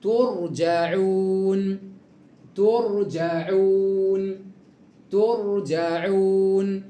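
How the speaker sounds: ترجعون said very slowly, ra thick; slow heavy ra. Shown as a demonstration. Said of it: "الراء ساكنة والحرف اللي قبل الراء عليه حركة الضم".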